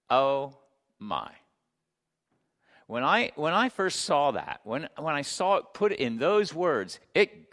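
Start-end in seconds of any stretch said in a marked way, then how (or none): none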